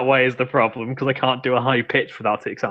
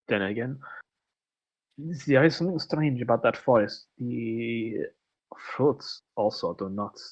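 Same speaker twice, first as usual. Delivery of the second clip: put-on halting, lightly French-accented character voice; pondering accented character; 3 semitones lower, 9 dB quieter than usual. Character musing about the strange forest, pondering accented voice.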